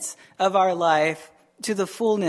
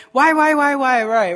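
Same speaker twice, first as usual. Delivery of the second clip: mock whining; 3 semitones higher, 5 dB louder than usual. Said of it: "shake our fist at the Lord and say".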